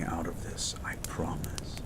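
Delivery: barely voiced whisper; whispered promise. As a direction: breathe the promise close to their ear. Promise to injured partner.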